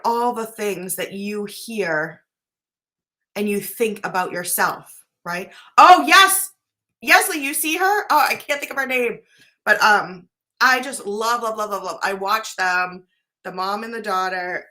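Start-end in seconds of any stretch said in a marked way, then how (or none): 5.8-9.2 bursting out high and loud; sudden delighted realization; thrilled shout of remembering; excited recognition. Real, not acted. Suddenly remembers the mom online.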